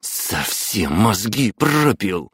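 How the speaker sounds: slow furious growl. Acted. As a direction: growl it at him. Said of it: "прорычала мама". (прорычать)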